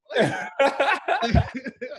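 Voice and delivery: high, loud burst of laughter. Real, not acted. Host laughing at his own catchphrase story.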